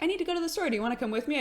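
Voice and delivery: mom's voice. Retold or imitated. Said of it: "she would be like".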